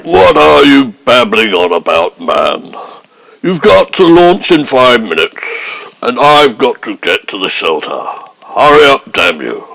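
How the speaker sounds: lightly voiced Prime Minister losing patience; a hint of Macmillan, testy and hurried; Harold Macmillan. Prime Minister snapping at Hancock to launch, lightly voiced. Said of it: "I'm the real Harold Macmillan".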